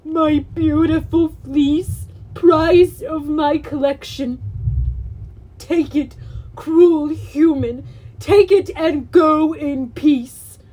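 loud, slow, mournful giant; sniffling monster. A narrator's reading. Cyclops gives up his prized fleece, sniffling lament. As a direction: lament heavily, word by word. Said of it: "The monster sniffled".

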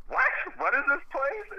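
very high, slow disbelief; astonished. Acted. Buyer hears of an old house for sale, astonished. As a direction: draw out the question high in amazement. Amazed reaction to news of house.

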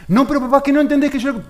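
put-on high, loud whining kid; whiny child protest. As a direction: whine like a spoiled boy refusing. Child protesting father's discipline.

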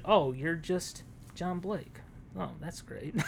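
understated letdown; deflated Gordon. Retold or imitated. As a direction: let the air out of him. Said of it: "Gordon's just like".

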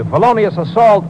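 normal talking voice

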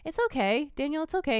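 high, gentle soothing; retold reassurance. Retold reassurance to frightened friend.

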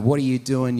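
mild pretend telling-off; mock chastising. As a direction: lightly scold him. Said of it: "I start chastising him".

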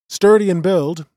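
usual speaking voice

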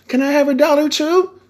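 put-on high pleading kid voice; begging youngster. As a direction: whine for a dollar. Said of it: "O G come around".

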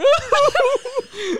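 very high, loud mock cry; mock wailing laugh. Host imagines rejected actress in restroom, mock wailing. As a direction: wail comically through laughter.

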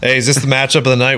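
usual speaking voice

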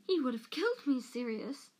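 high, pleading whine; whining. A narrator's reading. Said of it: "whined Peter".

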